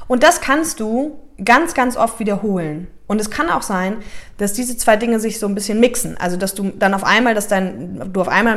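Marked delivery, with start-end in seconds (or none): none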